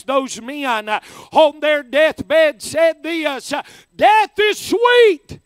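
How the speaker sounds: full-force preaching. Acted. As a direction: preach it out with fervour to the crowd.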